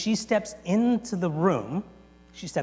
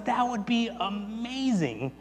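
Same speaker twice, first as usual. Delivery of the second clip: slow, higher-pitched gushing delight; ecstatic; 5 semitones higher, 5 dB quieter, slower than usual. Retold or imitated. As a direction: draw it out, thrilled by the idea. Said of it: "the wife comes out ecstatic".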